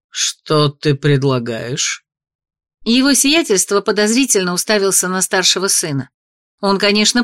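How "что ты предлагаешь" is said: low, very slow, wary; suspicious. Acted. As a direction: ask with deep suspicion. Suspicious father.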